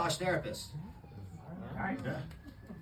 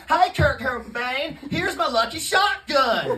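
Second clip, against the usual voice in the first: slow, louder Southern Gump drawl; Forrest Gump accent; 5 semitones higher, 7 dB louder, slower than usual. Pretend. Comic voices Gump greeting [name], slow Southern drawl. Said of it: "you can't do that accent".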